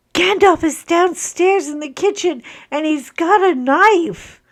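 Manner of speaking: put-on high, loud kid raising the alarm; imitated alarmed child. Mother retelling son's warning about dog with knife, imitated alarm. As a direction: report it urgently, imitating an excited boy. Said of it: "And he goes".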